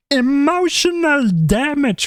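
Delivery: high, loud, slow Kermit squawk; hammed-up Kermit shout. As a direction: yell it like Kermit the Frog. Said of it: "my Kermit voice pop up".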